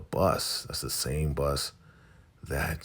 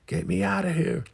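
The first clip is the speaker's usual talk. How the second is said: very high-pitched imitated scream; mock scream; 4 dB louder than usual. Wife screaming to escape Brian, high mock scream. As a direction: squeal it out like someone trapped. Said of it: "she starts screaming like".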